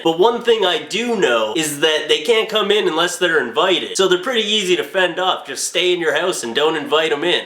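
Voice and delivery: ordinary delivery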